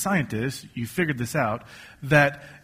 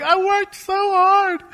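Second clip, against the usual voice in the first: strained high voice, drawn out slow; anguished cry; 3 dB louder, slower than usual. Retold or imitated. Preacher voicing a disappointed believer, high and drawn out. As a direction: cry out like someone whose hard work was wasted. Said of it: "you're like".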